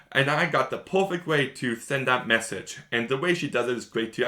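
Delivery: usual speaking voice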